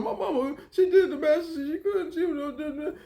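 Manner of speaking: high, wobbly fake sob; mock crying. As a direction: imitate him crying over his mama. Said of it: "he just crying for no motherfucking reason".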